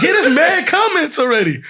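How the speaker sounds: high retold excitement with laughter in it; mock excited wife. Wife telling husband about the comments, high mock amazement. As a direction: imitate her amazed report.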